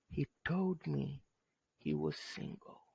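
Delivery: secretive hushed gossip to a friend; a friend passing on gossip in a whisper; gossipy whisper. Acted.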